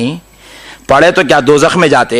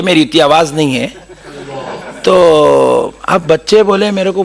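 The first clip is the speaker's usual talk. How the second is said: ordinary delivery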